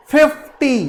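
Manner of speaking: Fifty with the first syllable stressed; stress on FIF. Shown as a demonstration. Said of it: "double syllable है ना".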